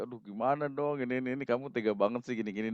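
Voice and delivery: quick, quiet mock complaint; lightly acted lovesick fretting; mock heartbroken whining. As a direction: play at being hurt, words tumbling out softly. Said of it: "baper gitu".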